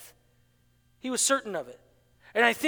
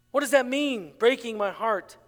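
ordinary talk

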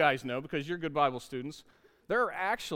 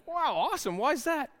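mock bright dad; 9 semitones higher than usual. Dad's bright reply to son.

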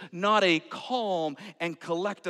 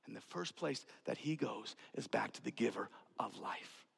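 lower, much softer whisper; 8 semitones lower, 12 dB quieter than usual. Acted. Preacher to congregation, whispering the key point. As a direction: breathe it out like a revelation.

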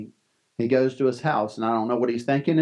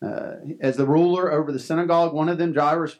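ordinary talk, nothing marked

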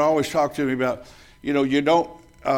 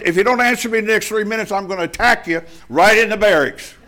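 higher and louder challenge; retold ultimatum; 6 semitones higher, 4 dB louder than usual. Sailor giving the bully an ultimatum, loud and high. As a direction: give him the final warning, hammed-up.

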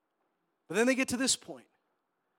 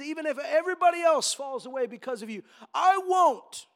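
high, emphatic pledge building to a loud finish; impassioned acted insistence; insistent vow; 8 semitones higher, 6 dB louder than usual. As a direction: protest your loyalty, rising to a shout. Peter insists on his loyalty.

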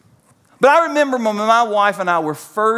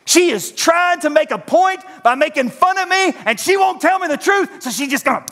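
sulky self-talk; 7 semitones higher than usual. Retold or imitated. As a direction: brood like a suspicious husband. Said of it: "and I would say this".

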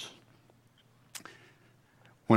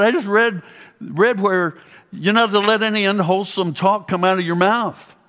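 much higher, louder, eager voice of Beth; Beth quoting scripture; 9 semitones higher, 4 dB louder than usual. Retold or imitated. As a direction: raise the pitch and quote her with bright surprise. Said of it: "She said".

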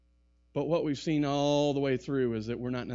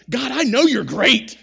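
backhanded compliment; 3 semitones higher, 10 dB louder than usual. Retold or imitated. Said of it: "He's like".

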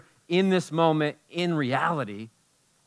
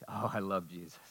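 hushed adoring sigh; 6 semitones lower, 13 dB quieter than usual. Real, not acted. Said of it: "sigh of devotion".

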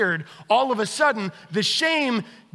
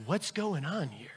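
hushed catching question; 4 semitones lower, 11 dB quieter than usual. Retold or imitated. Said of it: "And I open the door and go".

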